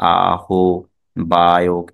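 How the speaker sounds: আহ্বায়ক drawn out as a-ho-ba-yok; very slow syllables in আহ্বায়ক, h and b sounded; mistaken pronunciation. Shown as a demonstration. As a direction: split it up, a-ho-ba-yok. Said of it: "এরকম কোনোটাই উচ্চারিত হবে না".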